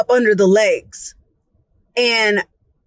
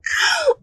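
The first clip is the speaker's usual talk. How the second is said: a high gasp of alarm; a sudden frightened gasp; panicked gasp. Retold or imitated. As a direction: gasp as the roach vanishes. Losing sight of roach.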